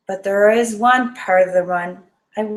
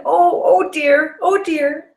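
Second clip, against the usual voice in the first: nervous moaning; 6 semitones higher, 4 dB louder, slower than usual. Retold or imitated. Bracing for the crash.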